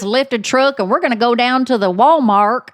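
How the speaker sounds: mock-mad Southern mama ordering a kid around; hammed-up Southern scolding. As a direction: play up a twangy Southern telling-off. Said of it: "when I'm mad".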